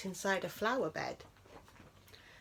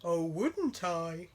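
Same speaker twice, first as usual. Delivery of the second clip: teasing bear; 3 semitones lower, slower than usual. A narrator's reading.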